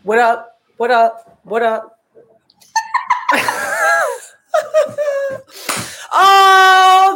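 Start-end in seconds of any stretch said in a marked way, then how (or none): 2.7-4.3 bright burst of high laughter; excited high laugh. Real, not acted. Laughs before greeting co-host. 6.1-7.1 loud, high, thrilled "Oh"; joyful shout of greeting; excited shout. Real, not acted. Excited opening to co-host.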